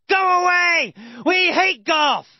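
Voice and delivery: shouted high and loud; angry yell. Acted. Tribespeople yelling at Tony, angry shout. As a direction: yell them away in anger. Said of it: "They'll yell".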